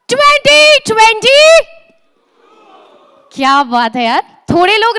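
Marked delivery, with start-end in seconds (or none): 0.1-1.4 higher, louder, slow stretched call; announcer hype. Acted. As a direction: sell the event name like a stage announcer. Host announcing the event name.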